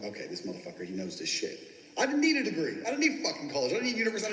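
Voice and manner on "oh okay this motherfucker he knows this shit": much lower and softer, grudging approval; grudging inner thought. Retold or imitated. Interviewers thinking about the cocky candidate, low and soft. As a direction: drop low and quiet, grudgingly impressed.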